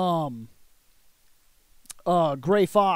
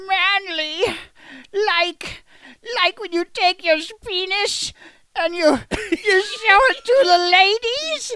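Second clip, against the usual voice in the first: much higher, loud squeak; mock squeaky voice; 9 semitones higher, 4 dB louder than usual. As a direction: squeak it like a silly kid. Mocking manly voice, high.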